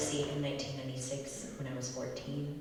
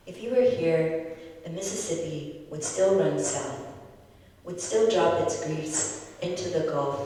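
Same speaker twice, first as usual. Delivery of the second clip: louder, earnest spoken-word delivery; poetry reading; 4 semitones higher, 7 dB louder than usual. Acted. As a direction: read it aloud like a poem onstage. Poet performs poem after intro.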